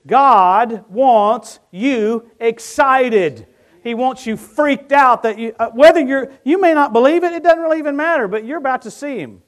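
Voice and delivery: loud, high, slow preacher's cry; booming revival shout; full-force preaching. Acted. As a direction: preach it out with fire.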